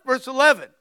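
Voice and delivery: ordinary delivery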